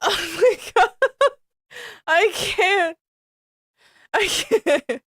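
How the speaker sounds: oh my God squeezed out through laughter; laughing. Real, not acted. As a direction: laugh your way through the words. Laughing at the fanfic.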